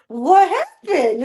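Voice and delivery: drawn out very slowly, innocent; toddler asking innocently. Retold or imitated. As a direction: stretch it out like a baffled little kid. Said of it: "he's like".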